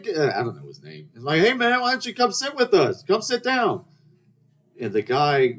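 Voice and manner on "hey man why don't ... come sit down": high-pitched hollered invitation; calling over. Retold or imitated. Diner invites supposed friend to sit, calling over. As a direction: call him across the room, pitched way up.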